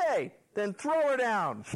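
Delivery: high, slow acted command; half-laughing mock holler; shouted order. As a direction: call the order up to a window.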